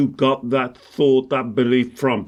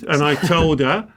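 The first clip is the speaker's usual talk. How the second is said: ordinary talk, nothing marked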